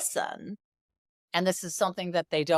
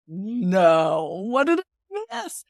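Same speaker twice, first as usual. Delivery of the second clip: alarmed; 3 semitones higher, 6 dB louder, much slower than usual. Retold or imitated. Mother alarmed daughter is crying.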